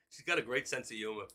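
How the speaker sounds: ordinary delivery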